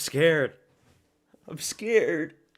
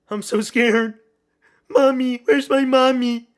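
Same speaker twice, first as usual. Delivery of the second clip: high whiny little-kid voice, pretending to want mommy; mock scared baby; 8 semitones higher, 11 dB louder than usual. Streamer watching the fight, hamming up a scared baby voice. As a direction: play a frightened toddler, loud and squeaky.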